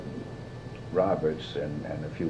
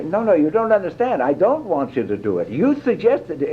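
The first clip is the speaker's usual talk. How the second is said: raised, insistent mock Billy voice; higher, pleading imitation of the director; Billy's protest; 9 semitones higher, 3 dB louder than usual. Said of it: "And Billy'd say".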